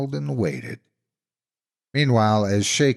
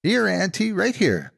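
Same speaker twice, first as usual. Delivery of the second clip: much higher, excited calling; eagerly; 12 semitones higher than usual. A narrator's reading. Little brother eagerly calling the monster to him. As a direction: wave her over eagerly. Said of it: "said eagerly".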